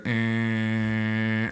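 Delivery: long monotone "ehhh" drone; imitated droning hum. Cat owner explaining what sets cats off, droning noise. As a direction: hold one steady, lowish note like a plane noise.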